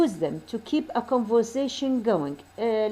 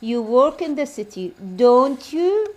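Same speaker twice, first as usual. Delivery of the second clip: "don't you" louder, rising to a question; rising tag intonation; 5 dB louder, slower than usual. Shown as a demonstration. Teacher repeating the city example for students, rising intonation on "don't you". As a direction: lift "don't you" firmly at the end. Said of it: "لازم نغمة مرتفعة".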